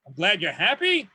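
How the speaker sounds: rising question tune on "happy"; mock uptalk, pitch climbing at "happy"; questioning Australian uptalk. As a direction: turn the statement into a question on "happy". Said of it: "everything in Australian ends with a question".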